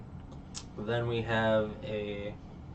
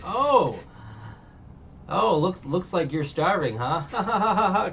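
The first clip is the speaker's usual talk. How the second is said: laughing tease; 8 semitones higher, 6 dB louder, slower than usual. Retold or imitated. Luffy teasing the starving pirate.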